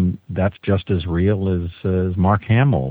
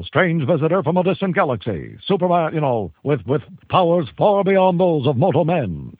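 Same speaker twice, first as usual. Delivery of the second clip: put-on high, booming old radio announcer; old newsreel announcer; 9 semitones higher than usual. Shown as a demonstration. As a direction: announce it like a vintage radio serial. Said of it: "that old newsreel, movie tone thing".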